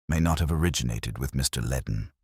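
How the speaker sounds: ordinary delivery, nothing marked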